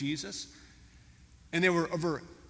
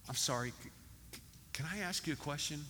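soft, stammering mock timidity; timid parrot; 8 dB quieter, faster than usual. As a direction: act out a humbled pet hesitantly asking. Humbled parrot timidly breaks silence.

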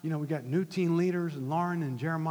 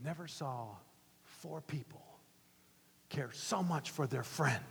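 hushed preaching; 7 dB quieter than usual. Real, not acted. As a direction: bring it down to a tender hush.

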